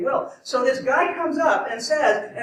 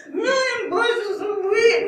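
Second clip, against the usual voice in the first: much higher, slow mock wail; mock crying; 11 semitones higher, 4 dB louder than usual. Freya to Odin, hammed-up high crying wail. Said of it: "starts crying".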